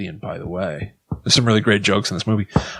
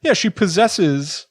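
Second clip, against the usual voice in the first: ordinary talk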